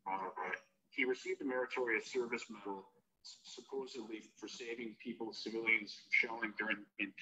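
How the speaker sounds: distant voice over a phone line; phone speaker. Real, not acted. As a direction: caller heard through speakerphone. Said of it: "You're on speaker".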